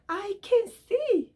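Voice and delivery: high, slow, joyful exclamation; joyful cry. Acted. Healed blind man rejoicing to his neighbors, joyful. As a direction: savor each word in delight.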